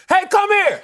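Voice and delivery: high street holler; sudden high, punchy summons; yelling at people. Pretend. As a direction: call a stranger over with a shout. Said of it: "and I yell at people".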